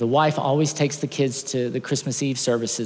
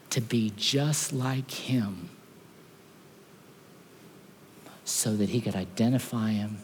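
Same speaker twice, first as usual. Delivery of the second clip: slow, much softer heartfelt telling; softer, slow, tender preaching; tender sermon close; 4 semitones lower, 6 dB quieter than usual. Acted. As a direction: draw them in gently, slowly. Sermon climax, slow and soft.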